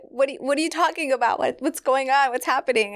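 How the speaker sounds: high, fast panicky questions; mock alarm, rapid-fire; patient reacts to the technician's silence, panicky; retold panic. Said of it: "And I was like".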